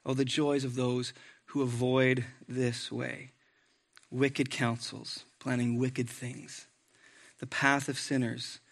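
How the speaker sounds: soft, slow reading of the psalm; quiet devotional reading; scripture reading. Acted. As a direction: read it gently, savoring each phrase. Reading Psalm one aloud.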